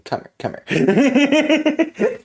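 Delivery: slow, high, laughing come here; laughing call. Acted. Character calling out, laughing.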